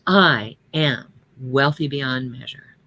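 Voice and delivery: slow, with "I am" weighted and lingered on; stress on I AM. Shown as a demonstration. Teaching congregation to say affirmations, stress on "I am". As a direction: lean hard on "I am", then let the rest follow slowly. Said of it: "use the "I am" very powerfully".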